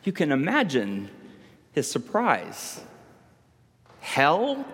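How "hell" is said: high, startled stammer; stammering in shock. A narrator's reading. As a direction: blurt it out, stunned. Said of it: "Paul stammered".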